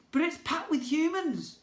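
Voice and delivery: put-on high, worried boy's protest; worried objection. A narrator's reading. Narrator boy warning the prince, high and worried. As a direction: object nervously.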